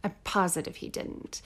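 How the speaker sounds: whispered aside. Real, not acted. Backing off her own theory to co-host, whispered. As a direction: breathe it out as a quick disclaimer.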